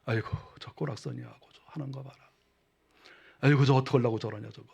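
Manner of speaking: soft contemptuous tutting; mock someone under your breath; sneering mockery. Preacher mimics his own sneering at others, contemptuous. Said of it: "저도 가끔 비웃어요".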